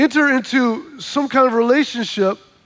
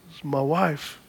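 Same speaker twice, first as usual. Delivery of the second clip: mild shame; 7 semitones lower, 8 dB quieter, slower than usual. Retold or imitated. Ashamed friend confessing.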